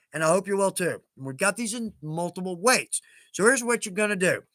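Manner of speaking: ordinary delivery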